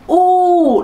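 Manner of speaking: u held long and drawn out. Shown as a demonstration. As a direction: stretch the u into a long vowel. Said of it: "đấy lại là âm u dài rồi".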